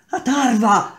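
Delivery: high, loud cry of a name; sharp high shout. A narrator's reading. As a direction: call out his name in alarm. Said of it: "gridò Alice".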